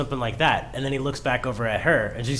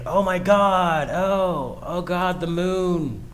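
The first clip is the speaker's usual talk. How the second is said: high, slow gasping awe; excited geeking out; 5 semitones higher, much slower than usual. Retold or imitated. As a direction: gush over the moon like a fanboy. Voicing character geeking out.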